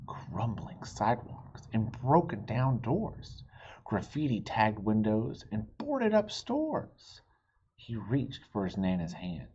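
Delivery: usual speaking voice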